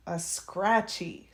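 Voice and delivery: ordinary delivery, nothing marked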